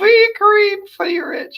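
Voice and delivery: high squeaky giggle through the words; can't hold it together, squealing with laughter; losing it laughing. Real, not acted. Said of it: "I'm losing it".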